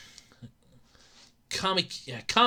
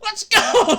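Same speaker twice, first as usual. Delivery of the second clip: excited shout; 11 semitones higher, 4 dB louder than usual. Real, not acted. Streamer pumping up chat, loud hyped shout. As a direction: yell it out hyped and high.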